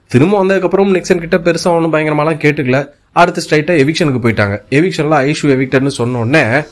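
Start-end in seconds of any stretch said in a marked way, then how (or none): none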